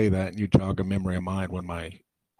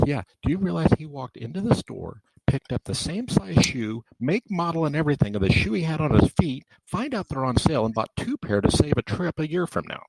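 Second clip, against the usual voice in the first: raised-pitch retelling of the wife's words; imitating wife; 7 semitones higher than usual. Husband imitates his wife explaining his shoe habit, raised pitch. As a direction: lift the voice higher to play the wife.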